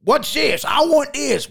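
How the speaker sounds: high-pitched, demanding customer imitation; high mock demand; rude customer. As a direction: mimic a pushy customer pointing at food. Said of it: "all kinds of rude".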